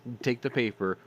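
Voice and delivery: ordinary delivery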